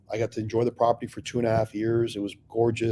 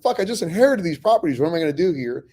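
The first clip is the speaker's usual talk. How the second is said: exasperated; 6 semitones higher, 5 dB louder than usual. Retold or imitated. Frustrated at inheriting bad properties.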